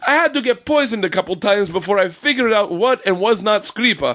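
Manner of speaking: high, excitable Italian husband impression; hammed-up Italian-accented storyteller. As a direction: do a comic Italian accent, pitched well up. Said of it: "Her husband's an Italian guy".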